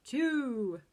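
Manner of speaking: loud heroic shout; superhero voice. Shown as a demonstration. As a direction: blast it out like a superhero. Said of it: "say in a superhero voice".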